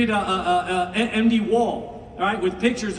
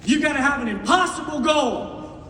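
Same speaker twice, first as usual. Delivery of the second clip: quoted shout; 3 semitones higher than usual. Retold or imitated. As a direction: bark the mentor's line back. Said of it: "he says this".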